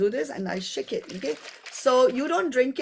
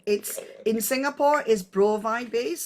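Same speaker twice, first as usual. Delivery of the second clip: normal talking voice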